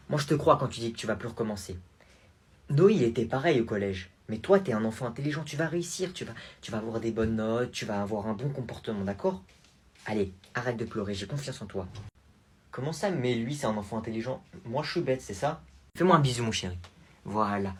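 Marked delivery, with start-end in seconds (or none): 0.1-11.9 reassuring aunt. Acted. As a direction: gently reassure the crying kid. (tante douce et rassurante)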